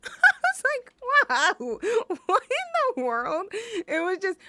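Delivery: laughing through the words, pitched high and loud; real laughter breaking into a disbelieving quote. Real, not acted. Cracking up at teacher's comment.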